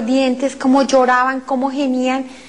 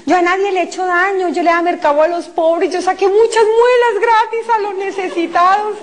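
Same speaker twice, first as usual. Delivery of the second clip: defensive pleading; 6 semitones higher than usual. Retold or imitated. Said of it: "Y empecé a decir".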